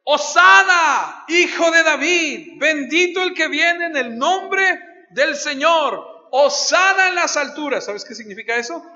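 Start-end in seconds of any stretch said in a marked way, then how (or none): 0.0-7.8 high, loud, slow drawn-out crowd cry; crowd shouting praise. Retold or imitated. Crowd greeting Jesus entering the city, high, loud slow shout. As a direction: proclaim it to the whole street, stretched out. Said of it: "la gente empieza a gritar".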